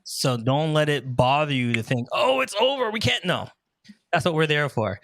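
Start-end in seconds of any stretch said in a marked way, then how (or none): 2.1-3.2 high-pitched imitation of a panicking client; mock panic. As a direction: act out a fretful "all is lost". Quoting a panicking bridal couple.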